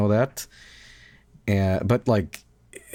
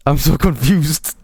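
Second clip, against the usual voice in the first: louder, slow bewildered whine; mock confused; 5 semitones higher, 6 dB louder, slower than usual. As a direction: drag it out, baffled and loud. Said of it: "he's like".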